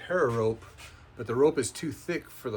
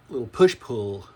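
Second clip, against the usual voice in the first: usual speaking voice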